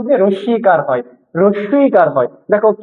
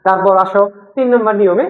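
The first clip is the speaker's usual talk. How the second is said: normal talking voice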